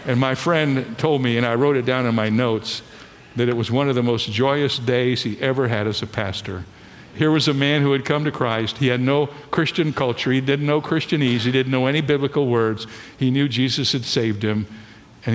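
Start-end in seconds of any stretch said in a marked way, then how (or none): none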